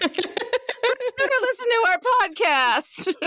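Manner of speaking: very high, slow squeal; high mortified wail; mock panicked squeal. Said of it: "I was like".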